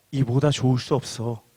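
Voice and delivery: low, soft, certain statement with falling end; clear assertion. Acted. Jesus telling listeners of their blessing, quietly certain. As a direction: state it quietly as settled fact. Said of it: "분명하게 말씀합니다".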